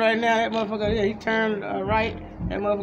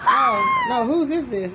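retold startled cry; 4 dB louder, slower than usual. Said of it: "he's still looking at her like".

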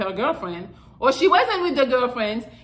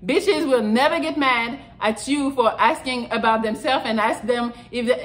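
usual speaking voice

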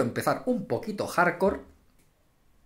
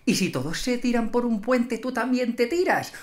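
imitating mother; 5 semitones higher, 4 dB quieter than usual. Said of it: "Y tu madre te dice".

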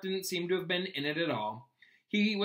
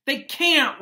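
high, loud anguished howl; a loud, high cry of outrage; 11 semitones higher, 11 dB louder than usual. Acted. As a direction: wail it in protest. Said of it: "Ron howled".

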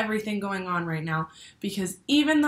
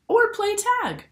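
playful lift; 13 semitones higher, 6 dB louder than usual. Acted. Playful end of list.

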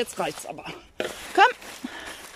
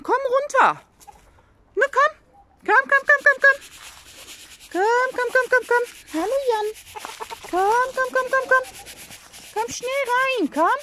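high, loud repeated komm calls; calling hens; 11 semitones higher, 3 dB louder than usual. Real, not acted. Owner calling her hens in before night, high loud repeated calls. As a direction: chant komm high and bright to call the flock.

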